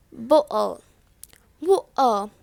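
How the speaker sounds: "bottle" as bo'ul, T replaced by a catch; glottal T. Shown as a demonstration. Teacher giving an example, glottal T. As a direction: stop the throat in the middle of "bottle". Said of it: "glottal T".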